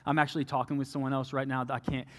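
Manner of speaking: low, very soft letdown; subdued refusal. Retold or imitated. Man turning down his crying ex, subdued refusal. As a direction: let them down quietly, voice dropped low.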